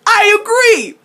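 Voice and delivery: a high, loud, chirpy "I agree!"; loud eager agreement. Retold or imitated. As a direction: blurt it out eagerly and too loud. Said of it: "Jace has to open his mouth and goes".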